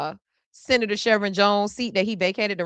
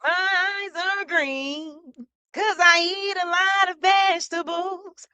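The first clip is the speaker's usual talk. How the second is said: put-on quote voice; 8 semitones higher than usual. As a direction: stretch each word at a high, held pitch.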